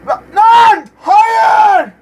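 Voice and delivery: loud high yell of frustration; frustrated shout. Real, not acted. Gamer yelling at the screen in frustration. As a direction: gamer yelling at a missed chance.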